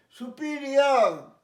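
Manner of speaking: সুপিরিয়র slow and loud, su-PI-riar; correct SUPERIOR. Shown as a demonstration. Teacher drilling pupils, loud su-PI-riar for সুপিরিয়র. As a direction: belt out সুপিরিয়র ending in "iar". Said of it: "পিরিয়র নয়".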